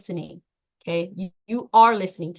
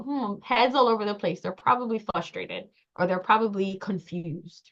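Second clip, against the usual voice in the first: musing, with a pondering hum first; thinking it over. Acted. Listener using critical thinking on caller's words, musing. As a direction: puzzle it out to yourself.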